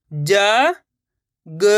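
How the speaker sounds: जा and ग each held long alone; slow, separated जा ... ग for pupils to repeat; letter by letter. Shown as a demonstration. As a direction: sound out जा, then ग, with a gap. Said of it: "एक ही अक्षर का उच्चारण करके".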